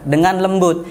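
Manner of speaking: ordinary delivery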